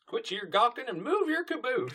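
very high put-on scolding voice; Granny Smith. Granny Smith hurrying her gawking grandchildren, hammed-up yell. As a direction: holler it as a hammed-up old granny. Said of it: "Granny Smith yelling at".

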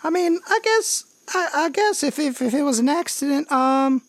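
high-pitched, hesitant stallholder voice; high, hesitant character voice; flustered pumpkin seller. Acted. Game master voices pumpkin seller.

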